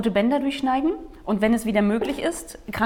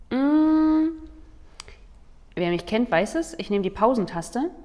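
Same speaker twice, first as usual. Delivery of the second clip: usual speaking voice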